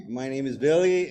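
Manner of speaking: ordinary talk, nothing marked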